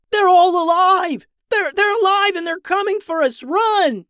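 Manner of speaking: high, loud screaming alarm; panicked yelling. A narrator's reading. Screaming scientists fleeing the nuggets, panicked yelling. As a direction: scream a frantic warning at the top of the voice. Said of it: "screaming scientists rushed out, yelling".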